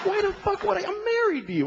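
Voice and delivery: ordinary delivery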